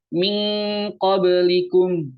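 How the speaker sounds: min qablikum with "min" held as a long "ming"; ikhfa with ng sound. Shown as a demonstration. Teacher showing the ng sound, "min" said as held "ming". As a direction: chant "min qablikum" slowly, "min" ending in NG. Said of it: "Bunyinya itu eng. Contohnya".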